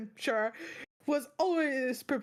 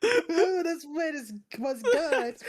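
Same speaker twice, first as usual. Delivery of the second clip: laughing; 6 semitones higher, 4 dB louder, slower than usual. Real, not acted. Cracking up at the joke.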